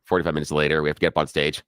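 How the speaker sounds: ordinary talk, nothing marked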